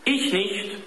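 put-on high child's voice; high-pitched boyish denial; insisting boy. A narrator's reading. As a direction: deny it quickly as a boy. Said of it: "versicherte Dieter".

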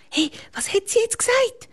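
put-on high boyish voice, hushed; a little boy asking in a hush; whispered child question. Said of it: "flüsterte Janik am Lukas ins Ohr".

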